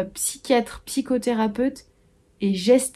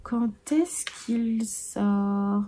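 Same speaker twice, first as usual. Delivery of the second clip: "Quand est-ce qu'il sort?" very slow and softer, drifting away; fading musing; 6 dB quieter, much slower than usual. Pretend. Wondering to herself about the release date, soft and slow. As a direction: let "Quand est-ce qu'il sort?" trail off dreamily, much slower.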